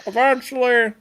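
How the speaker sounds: very high, loud put-on pitch; mock company rep. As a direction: parody a recruiter brushing off the cost. Acts out company's sales pitch.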